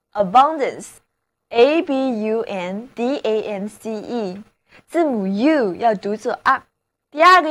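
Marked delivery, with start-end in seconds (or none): 0.1-4.4 letter by letter, unhurried; slow spelling. Shown as a demonstration. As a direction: spell each letter out slowly. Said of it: "重读音节". (慢慢逐个字母拼读)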